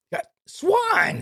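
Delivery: high-pitched exasperated cry. Real, not acted. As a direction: cry out at a bungling co-host. Exasperated at co-host's repeated slip.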